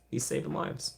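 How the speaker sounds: whispered. Shown as a demonstration. Streamer whispers a chat comment to viewers. Repeats chat line two ways.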